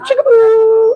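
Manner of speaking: very high cry-like squeal; high mocking squeal. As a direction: squeal it high like a breakup wail. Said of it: "All I heard was".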